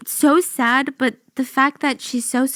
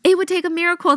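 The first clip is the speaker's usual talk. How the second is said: higher mock voice of doubting doctors; mocking doubters; 6 semitones higher, faster than usual. Host mocking the doctors who doubted her, higher. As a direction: parody the skeptics, pitched up. Same quote repeated.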